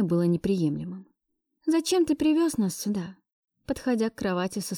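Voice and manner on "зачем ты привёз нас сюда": high-pitched, tense questioning; tense demand. Acted. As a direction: demand an explanation in a raised pitch. (напряжённый вопрос на повышенных тонах)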